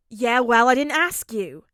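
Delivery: loud curt snap; snippy. A narrator's reading. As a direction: snap it back rudely. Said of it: "he snips".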